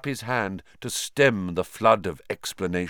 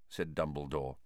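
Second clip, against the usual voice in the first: plain reading voice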